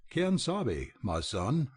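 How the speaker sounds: ordinary delivery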